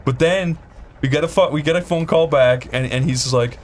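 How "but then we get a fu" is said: ordinary talk, nothing marked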